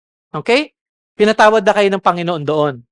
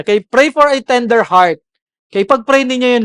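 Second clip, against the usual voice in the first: ordinary talk, nothing marked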